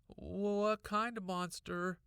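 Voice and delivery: put-on high, slow curious kid; little brother voice. A narrator's reading. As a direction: ask wide-eyed like a boy.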